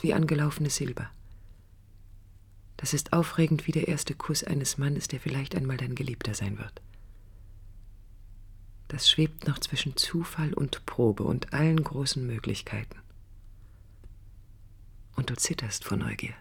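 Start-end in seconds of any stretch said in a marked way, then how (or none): 5.1-16.3 soft breathy whisper. Acted. Narrator whispering about the thrill of a first kiss. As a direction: confide it in a hush.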